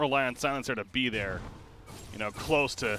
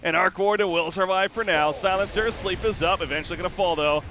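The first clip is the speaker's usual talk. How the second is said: raised voice easing down from the peak; live esports play-by-play; 6 semitones higher, 6 dB louder than usual. Real, not acted. Caster as Arc Warden survives for now, excited play-by-play easing off. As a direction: keep calling it, loud but settling.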